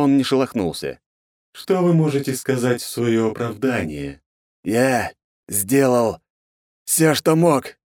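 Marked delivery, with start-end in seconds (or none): 1.5-4.2 high, grating divine voice; cold goddess interrogating her servants; inhuman goddess voice. Acted. 4.6-7.8 hoarse strained cry through agony; wheezing in pain. Acted. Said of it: "прохрипел он, сражаясь с терзающей его болью".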